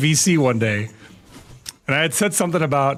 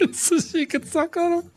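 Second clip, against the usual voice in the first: high, soft, slow and giggly; giggling words; 13 semitones higher, 5 dB quieter than usual. Real, not acted. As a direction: barely get it out through laughter. Laughing at own punchline.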